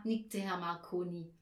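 usual speaking voice

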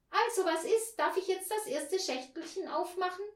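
put-on high girl's voice; eager girl Anja. A narrator's reading. Anja asks to open the first box, eager high voice. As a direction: ask brightly in a child's high pitch.

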